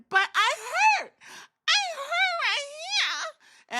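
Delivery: very high, slow crying whimper. Retold or imitated. Kairi whimpering to Asuka, very high slow mock cry. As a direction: whimper like a hurt child.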